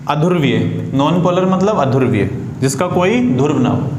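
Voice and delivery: ordinary talk, nothing marked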